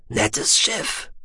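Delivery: a breathy, half-whispered alien voice; Shallowayne's voice. A narrator's reading. Shallowayne telling Tech it is too late, in a hushed alien whisper. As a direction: hold him in a hushed, husky whisper.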